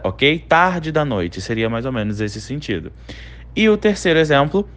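ordinary delivery

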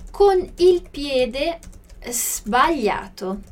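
slow, deliberate model phrasing; careful slow classroom demo; slow teaching read. Shown as a demonstration. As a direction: spell it out syllable by syllable for students. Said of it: "Olha essa pronúncia do sbagliato".

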